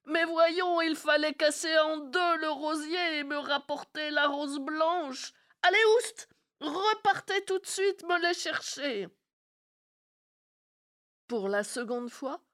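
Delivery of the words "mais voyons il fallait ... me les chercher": high, loud, exasperated orders; angry scolding shout. Acted. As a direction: chase the servants off in a rage. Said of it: "hurla-t-elle".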